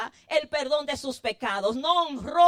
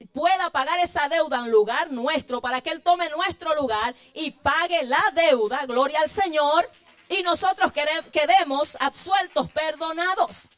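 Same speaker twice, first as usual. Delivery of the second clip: louder, rising preacher's cry of praise; fervent preaching; 2 semitones higher, 6 dB louder than usual. Acted. Preacher to his congregation, loud fervent preaching. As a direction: preach it out full force to the congregation.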